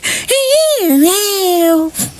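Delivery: high squeal of laughter; real giggling fit; high giggle. Real, not acted. Laughs after cat demo.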